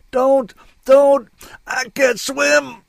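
high, loud cry of panic; desperate shout. A narrator's reading. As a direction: shout it out as if drowning. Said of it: "I shouted".

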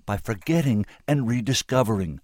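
loud, high, urgent pleading; impassioned outburst. A narrator's reading. Woman finishing her plea to the senator, impassioned. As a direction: build to a high, forceful climax.

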